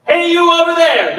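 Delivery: big, high projected holler; loud, thick chest-voice shout; big call-out. Shown as a demonstration. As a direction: call across the room, nice and big. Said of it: "Nice and big".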